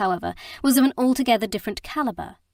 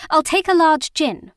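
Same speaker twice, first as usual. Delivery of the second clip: much higher, louder defiant order; said loudly; 8 semitones higher, 9 dB louder than usual. Acted. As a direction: call it out over his shoulder in defiance. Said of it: "she said loudly".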